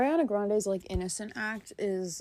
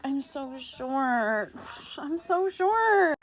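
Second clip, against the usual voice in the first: slow, high, cutesy whine that climbs higher; mock tiny girl voice; 6 semitones higher, much slower than usual. Mocking girls who play up being short, in a high slow baby voice. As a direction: drag it out like a girl fishing for compliments. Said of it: "the embodiment of girls who are like".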